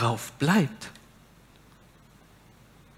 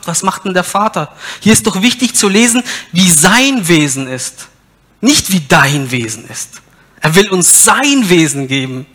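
preaching at full force; 3 semitones higher, 9 dB louder than usual. Real, not acted. Preacher tells the congregation God still answers, loud preaching. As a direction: build the sermon to a loud peak.

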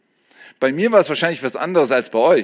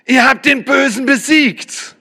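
raised high, proclaiming victory; triumphant call-out; 9 semitones higher than usual. Acted. Preacher calling out the verse's answer to the youth triumphantly. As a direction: announce it like a victory cry.

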